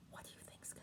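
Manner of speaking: unvoiced suspense whisper. Real, not acted. Asking the children what happens next, whispered. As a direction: hush them into suspense.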